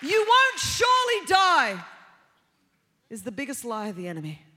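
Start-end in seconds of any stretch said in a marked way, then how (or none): 0.0-1.8 high, loud, drawn-out; loud slow serpent quote. Retold or imitated. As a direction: drag out the serpent's lie.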